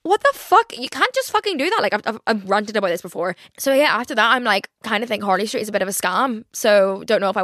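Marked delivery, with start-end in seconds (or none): 0.0-1.8 high-pitched, indignant retold outburst; re-enacted incredulous swearing; outraged retort. Retelling outraged reply to the clinic.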